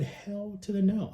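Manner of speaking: slow, drawn-out sass; drawn-out hell no with attitude; sassy refusal. Retold or imitated. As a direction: spell out the no, emphatically. Refusing to finish football.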